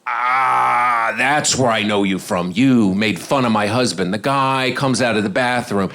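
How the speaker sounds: slow, low, drawn-out accusing voice; dawning recognition. Retold or imitated. Lady recognising the comedian, slow accusation. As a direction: stretch the words as it dawns on you.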